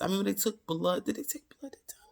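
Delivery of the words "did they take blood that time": voiceless self-correction; a hushed aside checking her memory; whispered aside. Real, not acted. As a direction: whisper the question to yourself.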